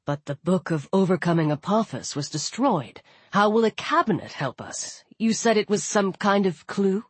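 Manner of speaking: frowning, sceptical companion; doubtful young man. Acted. Voices Walt, frowning.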